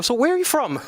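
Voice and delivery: curious cashier. Retold or imitated. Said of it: "the guy taking your order".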